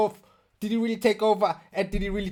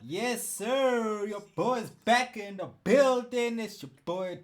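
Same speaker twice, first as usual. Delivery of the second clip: hype host intro; 4 semitones higher, 4 dB quieter, slower than usual. Acted.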